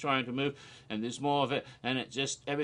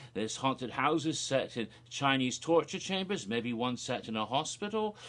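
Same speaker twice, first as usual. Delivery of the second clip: ordinary delivery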